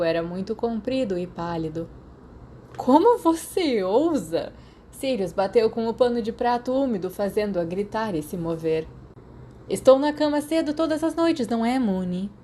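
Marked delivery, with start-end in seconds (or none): 2.8-4.5 slow, high, loud faked indignation; mock outrage. A narrator's reading. Sirius fakes outrage at Marlene, slow and loud. 9.7-12.3 high, sing-song innocent voice; mock innocence. A narrator's reading. Sirius teases Moony with high fake innocence.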